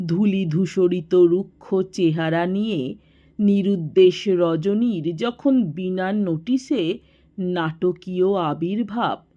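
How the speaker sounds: usual speaking voice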